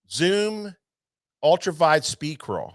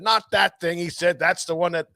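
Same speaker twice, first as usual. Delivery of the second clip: retold yelling; 6 semitones higher, 4 dB louder than usual. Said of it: "he was yelling".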